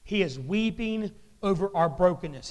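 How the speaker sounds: ordinary talk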